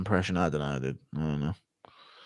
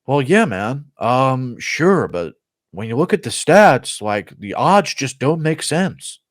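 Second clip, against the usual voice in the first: slow, slightly higher, swooping voice; Anthony Smith impression; 3 semitones higher, slower than usual. Anthony Smith arguing from the stats, slow excuse-making impression. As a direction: make excuses slowly, pitch rising and dipping. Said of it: "Anthony Smith and Presh".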